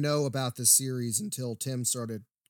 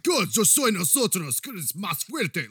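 slow mock Spanish dub, higher; hammed-up Spanish dubbed Harry; 5 semitones higher, 4 dB louder, slower than usual. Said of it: "Harry comes in and his voice".

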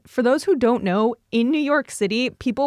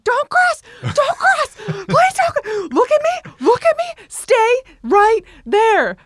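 high, loud panicked parent yell; screaming parent; 6 dB louder, slower than usual. Retold or imitated. Parent to a kid at the street corner, screaming. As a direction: shriek commands like a parent at a crossing. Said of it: "you just hear the parents screaming".

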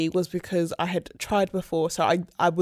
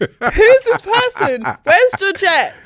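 much higher and louder, slow and playful; squeaky put-on voice; 13 dB louder, slower than usual. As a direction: play a stranger in a squeaky voice. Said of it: "Why are you using that voice?".

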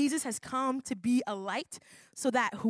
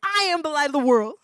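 higher and louder, full of confidence; confident declaration; 5 semitones higher, 10 dB louder than usual. Shown as a demonstration. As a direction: proclaim it boldly. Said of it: "Say it with some confidence".

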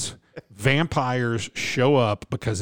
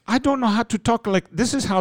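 higher-pitched mock Indian accent; 9 semitones higher than usual. Shown as a demonstration. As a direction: demonstrate a put-on Indian accent. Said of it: "if you do an Indian accent".

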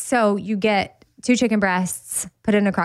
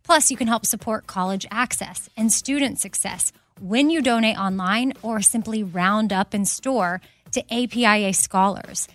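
upbeat sponsor read, quick and bright; host reading a Macy's sponsor spot; 4 dB quieter than usual. Acted. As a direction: sell it like a podcast ad.

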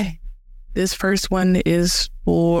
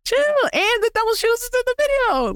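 high excited. Real, not acted. Laughing, telling him to chill.

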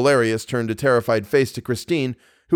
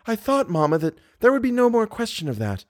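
put-on higher young girl, quick to cut in; young woman voice; 10 semitones higher than usual. A narrator's reading. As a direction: break in, blushing and eager. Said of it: "the girl broke in promptly".

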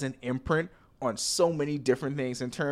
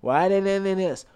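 mock whining, slightly higher; whiny Bahamian; 3 semitones higher than usual. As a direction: whine the same gripe over and over. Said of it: "This is my impression of a whiny Bahamian".

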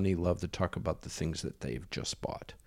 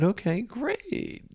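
mock eager agreement; much slower than usual. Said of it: "I said".

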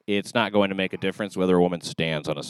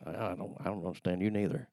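soft, lightly put-on Southern accent; understated Southern voice, played for laughs; 8 dB quieter than usual. Shown as a demonstration. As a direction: answer quietly in mock-Southern. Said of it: "me doing a Southern accent".

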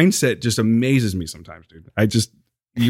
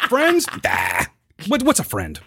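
higher, dismissive impression of Steve; mocking Steve; 11 semitones higher than usual. Mocking his friend, a higher dismissive impression of Steve. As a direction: scoff like a loner who needs nobody. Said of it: "That's Steve Hudson".

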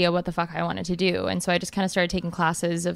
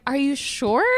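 groggy confused mom; 7 semitones higher, slower than usual. Retold or imitated. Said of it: "And she was like".

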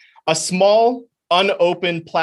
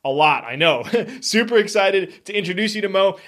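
ordinary delivery

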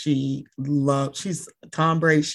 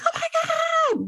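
very high, drawn-out pretend squeal; mock scream; slower than usual. Imagining spotting her therapist out in public, mock screaming. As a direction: squeal it slowly as a held-back fan scream. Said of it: "like, screaming".

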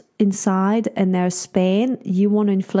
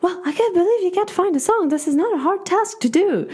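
quick, higher, exasperated nagging; annoyed wife; 10 semitones higher than usual. Retold or imitated. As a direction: huff at him that this is easy. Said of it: "I'm like".

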